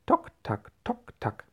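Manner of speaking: high, clicked imitation of a peg leg; sing-song knocking footsteps; wooden leg knocking. A narrator's reading. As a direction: tick out the steps in a high voice. Imitates wooden leg's walking sound.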